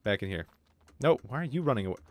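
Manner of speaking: ordinary talk, nothing marked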